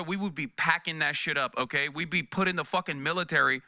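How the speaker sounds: usual speaking voice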